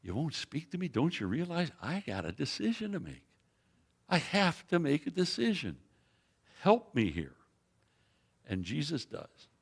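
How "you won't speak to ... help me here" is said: softer, earnest pleading question; pleading Pilate. Retold or imitated. Preacher voicing Pilate asking Jesus for help, soft and pleading. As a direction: quietly beg for help with a decision. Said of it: "I think what he is saying is".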